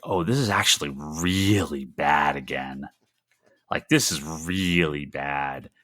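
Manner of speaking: weary despair. Retold or imitated. A fan reliving season four, slow and defeated. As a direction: drag it out, defeated by the show. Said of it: "and I'm like".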